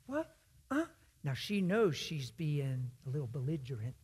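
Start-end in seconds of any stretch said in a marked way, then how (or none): 0.1-0.9 stunned surprise. Retold or imitated. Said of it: "She's undone".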